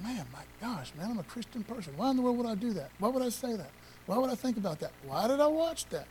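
a believer catching himself, half under his breath; guilty self-talk. Retold or imitated.

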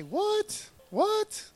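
mock-astonished, high-pitched WHAT; incredulous high squeal of a question; exclaimed "what". Shown as a demonstration. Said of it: "Everybody say".

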